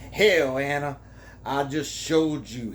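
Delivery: usual speaking voice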